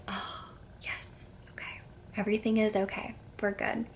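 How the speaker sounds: breathy half-whispered self-reassurance; hushed relief. Retold or imitated. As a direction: let it out softly, like relief retold. Retelling her relief at heartbeat.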